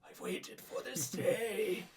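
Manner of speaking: high, slow, strained elder; long-awaited moment in an old man's strained voice; raspy old man. Acted. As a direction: draw it out like a moved old wizard. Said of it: "he has a really raspy, like, strained old man voice".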